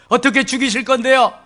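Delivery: loud, eager prying; nosy demand. Acted. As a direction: press for the rival's fate. Peter about his rival John.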